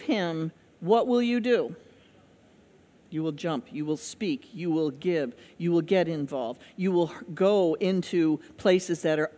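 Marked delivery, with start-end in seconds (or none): none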